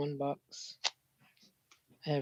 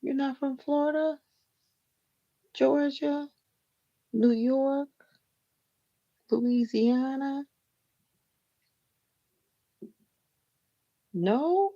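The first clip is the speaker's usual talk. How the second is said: excited guessing; 8 semitones higher, 4 dB louder, slower than usual. Real, not acted. Guessing viewer's home state excitedly.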